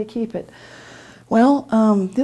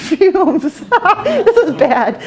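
laughing through the words, high and louder; 8 semitones higher, 5 dB louder, much slower than usual. Real, not acted. Telling audience about dishwasher fumes, laughing. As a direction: break up laughing while telling it. Laughing at her own story.

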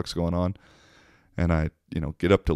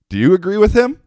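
much higher and louder, calling out; loud retold question; 13 semitones higher, 9 dB louder, faster than usual. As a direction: call it out high to the other guy. Asks the other urinal guy.

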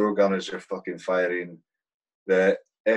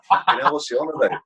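higher, louder baffled exclamation; bewildered; 7 semitones higher, 5 dB louder than usual. Retold or imitated. Soldier baffled by the gunner, in a loud bewildered exclamation. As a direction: sound totally confused. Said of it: "And I".

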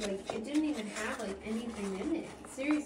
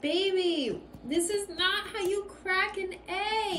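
high, slow exasperated scolding; 8 semitones higher, slower than usual. Real, not acted. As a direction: drag it out in disbelief. Scolding over cracked egg.

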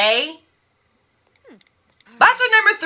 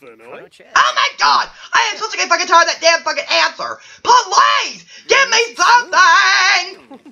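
hammed-up fed-up shouting; exasperated host; 3 semitones higher than usual. Host fed up with bachelor one's answer, exasperated shouting. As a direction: rant in exasperation, stretching the last plea.

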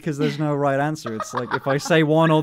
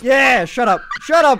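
higher, louder embarrassed shut up; flustered shout; 8 semitones higher, 7 dB louder than usual. Real, not acted. Flustered streamer yelling at his teasing friend. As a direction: blurt it out defensively.

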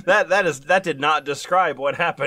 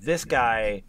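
usual speaking voice

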